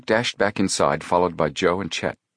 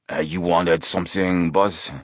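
brawny henchman. A narrator's reading. Brawny henchman speaks to boss.

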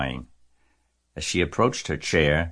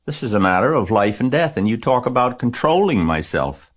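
put-on higher, louder indignant child; girl arguing with her father, louder; upset girl; 6 semitones higher, 4 dB louder than usual. A narrator's reading. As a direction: protest hotly, near tears. Angry girl argues with father.

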